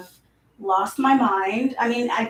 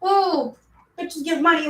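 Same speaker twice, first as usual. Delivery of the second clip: sassy quote; 7 semitones higher than usual. Retold or imitated.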